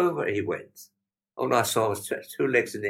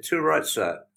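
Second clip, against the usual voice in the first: higher, louder touch of Australian; retold reply in mild Australian; imitated Australian accent; 7 semitones higher, 5 dB louder, slower than usual. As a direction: lightly put on an Australian accent. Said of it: "Australian accent".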